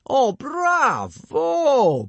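a very slow, high, loud bravo; booming. A narrator's reading. Gobber praises Snotlout to the class, booming loud. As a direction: boom out the praise. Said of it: "boomed Gobber".

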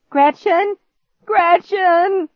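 high, loud, repeated name call; excited shouting. A narrator's reading. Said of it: "he shouted my name over and over".

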